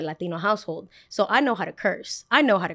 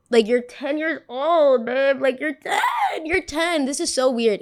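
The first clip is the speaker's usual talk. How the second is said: disgusted disbelief; 6 semitones higher, slower than usual. Real, not acted.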